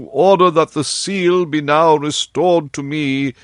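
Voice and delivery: a humble official petitioning his king; Lord Chancellor. Acted.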